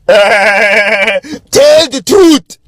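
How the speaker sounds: loud, high mock African accent. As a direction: hammer it out in a fake African accent. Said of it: "the accent's just fake as shit".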